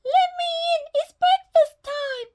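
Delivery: wolf faking a sweet little voice; wolf's fake squeak. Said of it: "squeaked the wolf".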